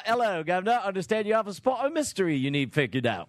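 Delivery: mock British mystery man; put-on British detective patter; terrible fake British accent. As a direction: ham up a bad British accent. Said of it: "my terrible fake accent".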